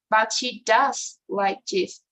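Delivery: slow, with "does" stressed for emphasis; emphatic "does", carefully spaced. Shown as a demonstration. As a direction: lean on "does" to insist it is true. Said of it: "it is always stressed".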